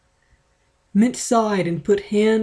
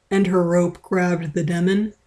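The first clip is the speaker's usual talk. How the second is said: usual speaking voice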